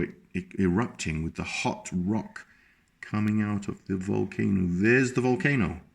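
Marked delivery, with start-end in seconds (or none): none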